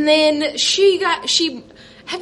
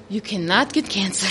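solemn doctor; 8 semitones lower, 5 dB quieter, faster than usual. Retold or imitated. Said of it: "she's like".

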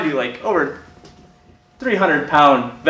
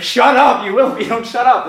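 higher, exasperated retort; exasperated silencing; 7 semitones higher than usual. Retold or imitated. Friend answering a panicking teen's what-ifs, exasperated silencing. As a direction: cut off the worrier. Friend snaps at repeated worries.

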